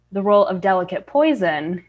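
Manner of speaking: ordinary delivery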